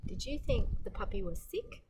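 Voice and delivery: usual speaking voice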